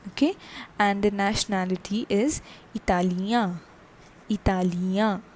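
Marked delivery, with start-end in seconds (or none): 2.7-3.6 "Italian" as ee-ta-lya, no final n; masculine French pronunciation. Shown as a demonstration. Teacher giving the masculine nationality, "Italian" ending in nasal ya. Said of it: "the same as that for the Andean". 4.3-5.2 "Italian" as ee-ta-lya, no final n; masculine French pronunciation. Shown as a demonstration. Teacher giving the masculine nationality, "Italian" ending in nasal ya. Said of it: "the same as that for the Andean".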